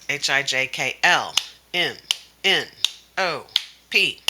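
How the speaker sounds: L, M, N, O, P each said alone, with gaps; letters separated. Shown as a demonstration. Teacher showing how to avoid running L-M-N-O-P together, separated letters. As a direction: recite to L, then give M, N, O, P one beat each. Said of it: "have them clap after L".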